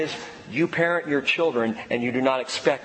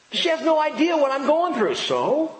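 put-on whiny teen; 11 semitones higher, 3 dB louder than usual. Kid complaining, mimicked.

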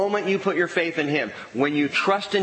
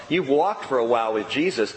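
normal talking voice

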